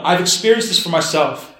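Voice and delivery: ordinary talk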